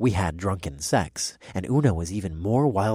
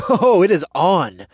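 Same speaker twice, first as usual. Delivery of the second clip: excited; 11 semitones higher, 11 dB louder than usual. Acted. Playful chase begins.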